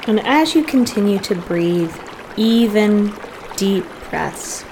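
soft, unhurried meditation voice with long pauses; guided meditation. Real, not acted. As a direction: gently guide listeners into settling and breathing.

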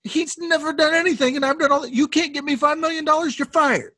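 very high-pitched indignant rant; indignant protest. Acted. Objecting to someone's five million dollar pay, indignant.

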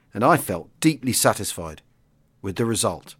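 steady, measured memoir reading; clear, even storytelling voice; audiobook narration. A narrator's reading. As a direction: read it like a wartime history audiobook. Reads a memoir aloud.

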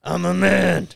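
booming manly declaration; proud, loud and puffed up; macho announcer voice. Pretend. Loud deep-voiced opener.

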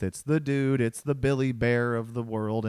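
ordinary talk, nothing marked

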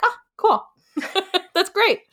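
high, bright "Oh, cool" breaking into laughter; surprised delight. Retold or imitated. Stepmom's apology touches her, she laughs delightedly. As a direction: squeal with surprised joy and laugh it off. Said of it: "I was like".

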